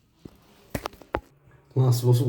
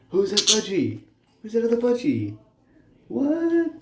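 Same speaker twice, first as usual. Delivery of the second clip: higher, teasing sing-song to the birds; playful pet voice; 10 semitones higher than usual. Real, not acted. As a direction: tease the budgies like babies. Teasing the budgies.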